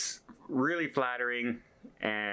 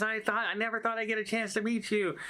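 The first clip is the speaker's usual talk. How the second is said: higher, thrilled admission; starstruck crew man; 11 semitones higher than usual. Retold or imitated. Photo worker telling the vlogger he's thrilled, starstruck and higher. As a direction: gush it, starstruck and high.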